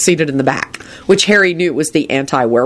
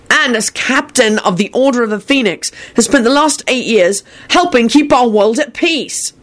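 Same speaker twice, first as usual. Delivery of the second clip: impassioned defense speech; 8 semitones higher than usual. Acted. Harry argues in court.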